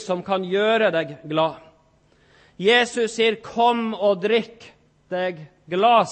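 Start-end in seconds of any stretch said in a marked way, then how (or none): 3.4-6.1 slow invitation. Acted. Jesus' invitation, repeated.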